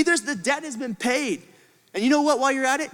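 ordinary talk, nothing marked

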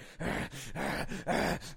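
repeated forceful huffs; fighter's huffing. Retold or imitated. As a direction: huff hard like a psyched-up fighter. Imitates pumped-up fighter entering.

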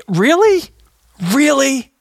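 high, loud incredulous really; exasperated disbelief. Retold or imitated. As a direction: throw up your hands in disbelief. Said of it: "I'm like".

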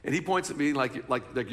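ordinary delivery

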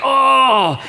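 high, loud mock groan of pain; faked pain groan. Kid faking appendicitis to parents, loud hammed-up groan. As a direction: ham up a fake stomachache. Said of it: "And I'd be like".

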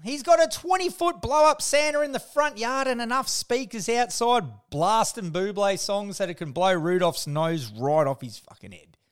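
normal talking voice

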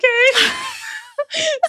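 high shrieking laugh of relief. Real, not acted. After running through the arrow, shrieking laugh. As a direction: let out a big triumphant laugh.

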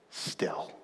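whispered, barely there; hushed whisper. Shown as a demonstration. Preacher shows the church stillness, whispered. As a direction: let the word drop to a whisper. Demonstrating stillness after long pause.

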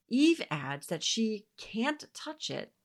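ordinary talk, nothing marked

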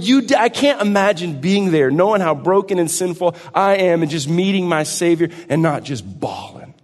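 rising from earnest to loud and high; building sermon. Acted. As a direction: build the passion line by line.

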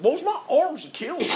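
put-on high, quick country-accented complaint; country aunt. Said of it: "she's very country".

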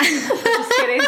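slow words broken by high laughter; laughing. Real, not acted. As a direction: laugh through it. Laughing at her own joke.

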